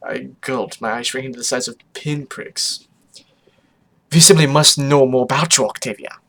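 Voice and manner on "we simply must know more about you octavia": put-on loud, eager accented exclamation; crying out. Photo Finish demanding to know Octavia, loud cry. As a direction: exclaim it boldly, falling on the name. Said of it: "Photo Finish cried".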